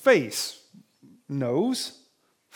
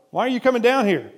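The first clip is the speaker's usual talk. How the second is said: mock anger; 6 semitones higher, 4 dB louder, faster than usual. Preacher voices angry brother again.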